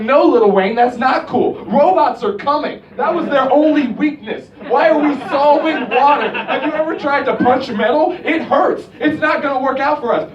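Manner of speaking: half-laughing mock alarm; exasperated rant. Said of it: "And I'm like".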